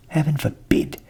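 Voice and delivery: the ass, dismayed, loud; appalled ass; shocked donkey. A narrator's reading. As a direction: gasp out heaven forbid.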